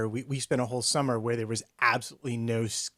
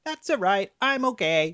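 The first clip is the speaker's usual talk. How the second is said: put-on high, loud little-kid self-reassurance; pitched-up mock brave kid; imitating child's verbal tic; 7 semitones higher, 6 dB louder, slower than usual. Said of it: "developed this little verbal tick".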